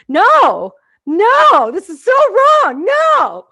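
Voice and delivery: high, drawn-out yells of protest; hammed-up fury at full volume; outraged shouting. Viewer yelling back at the health commercials, exaggerated outrage. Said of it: "I get so mad".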